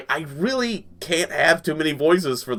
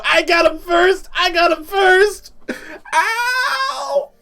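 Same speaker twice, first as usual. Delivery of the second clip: high, loud, drawn-out screams; excited shrieking; 5 dB louder, much slower than usual. Acted. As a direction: shriek it out way up high, ending in a yelp. Kids racing uphill, shrieked in retelling.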